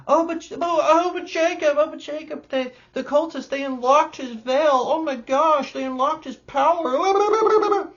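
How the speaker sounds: high, loud, breathless mock gasping; mock wizard gushing. Reviewer mocking the show's clumsy reveal, squeaky hammed-up awe. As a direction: ham up the awed discovery in a squeaky voice.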